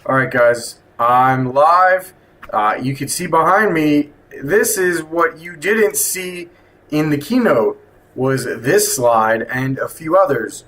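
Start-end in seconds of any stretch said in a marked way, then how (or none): none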